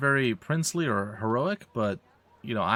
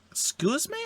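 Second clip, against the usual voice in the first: high surprised call. Acted. Interrupting brigands, calling out.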